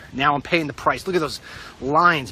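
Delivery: ordinary talk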